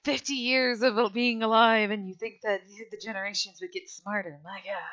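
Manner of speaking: loud, high exasperation that eases off; exasperated grumbling. Acted. Dilly sweeping up after the kids, exasperated. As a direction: grumble in character, starting big and trailing down.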